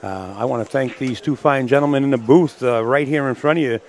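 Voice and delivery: loud, projected match-result announcement; sports announcer wrap-up. Real, not acted. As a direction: announce the winner and credit the booth, loudly. Announces result and thanks the booth.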